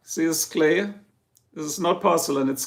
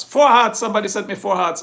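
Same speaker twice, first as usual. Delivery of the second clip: sudden loud, high burst, then a quicker rush; excited reaction; 4 semitones higher, 4 dB louder than usual. Real, not acted. Streamer thrilled at hearts from a viewer, excited. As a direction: burst out excitedly as the count goes up.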